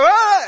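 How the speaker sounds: sudden high loud scream; imitated sleep-talk yell; child's night scream. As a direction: mimic a kid yelling in his sleep. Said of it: "Y a veces empieza".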